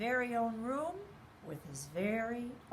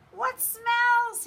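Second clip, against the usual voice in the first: high, loud shocked squeal; bunny squealing at the stink; startled cry; 14 semitones higher, 9 dB louder than usual. A narrator's reading. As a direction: jump up and cry out in disgust. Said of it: "he asked as he jumped up".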